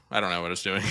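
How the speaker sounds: normal talking voice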